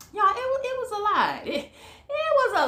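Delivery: high, breaking into a laugh. Real, not acted. Reviewer laughing off the wife's hard times, with a high laugh. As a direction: laugh through the words.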